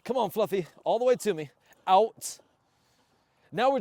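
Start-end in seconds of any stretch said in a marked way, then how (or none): 0.0-2.4 louder, higher call to the dog. Real, not acted. Trainer calling dog back, then loud command. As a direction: call him all the way in.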